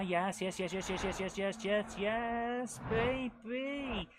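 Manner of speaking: excited cheering; excited yes. Real, not acted. Winning moment.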